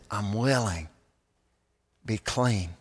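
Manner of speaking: soft, low, tender acted reply; compassionate. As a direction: speak gently to someone in need, with pauses. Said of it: "you can see the compassion in his eyes".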